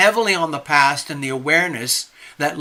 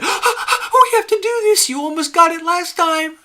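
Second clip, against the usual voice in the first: excited urging; 14 semitones higher, 4 dB louder than usual. Retold or imitated. Love Triber urging the speaker to enter, high excited plea. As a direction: beg eagerly in a much higher voice. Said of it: "And Love Triber said".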